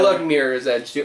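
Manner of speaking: usual speaking voice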